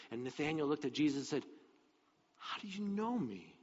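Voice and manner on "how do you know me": high, soft, wondering question; hushed amazement. Acted. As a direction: ask it softly in astonishment, pitch lifted. Nathanael amazed at Jesus knowing him.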